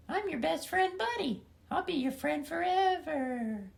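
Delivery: very high, slow creepy doll voice; sing-song it like a haunted toy. Acted. The doll Buddy to the trapped boy, very high and creepy. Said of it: "And Buddy's like".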